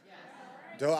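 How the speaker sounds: ordinary delivery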